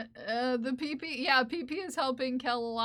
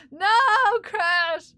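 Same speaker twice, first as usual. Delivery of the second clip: much higher, loud no crash; shocked outcry; 6 semitones higher, 9 dB louder than usual. Real, not acted. Streamer crying out as something crashes, shocked outcry. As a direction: cry out in alarm.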